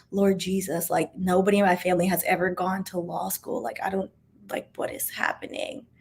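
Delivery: fading to an unvoiced whisper; panicked whisper. A scared first-generation student praying to herself, fading to a whisper. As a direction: let the fear fade to a whisper.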